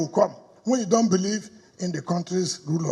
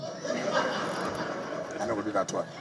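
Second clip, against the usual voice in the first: whispered laugh; 10 semitones lower, 10 dB quieter, much slower than usual. Real, not acted. Laughing refusal in pidgin.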